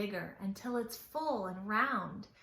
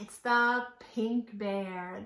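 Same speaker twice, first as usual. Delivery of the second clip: usual speaking voice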